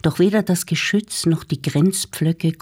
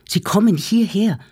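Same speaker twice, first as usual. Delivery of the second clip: rising alarm; 7 semitones higher than usual. Acted. Arnost warns his family the Germans are coming, panicked. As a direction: push the alarm up a notch, still winded. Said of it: "schwer atmend".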